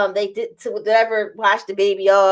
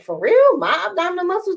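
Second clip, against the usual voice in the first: higher-pitched mock surprise; retold disbelief; 7 semitones higher than usual. Patient doubting the surgeon's praise of her muscles, retold surprise. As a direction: act out being taken aback.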